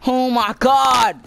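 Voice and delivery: slow, high, loud cry; anguished shout. Real, not acted. Gamer missing his positive score, anguished shout. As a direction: draw it out in frustration.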